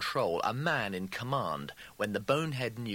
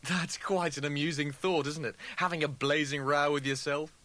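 higher-pitched, mock-amused dismissal; forced amusement; 7 semitones higher, 3 dB louder than usual. A narrator's reading. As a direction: brush it off as a joke. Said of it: "Rimmer laughed".

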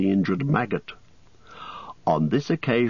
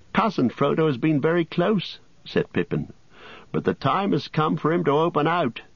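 lightly raised, prodding hobbit voice; teasing Pippin urging his cousin; 6 semitones higher than usual. A narrator's reading. Pippin prodding his silent cousin, teasing. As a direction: nudge Frodo to open up at last. Pippin urges Frodo to speak.

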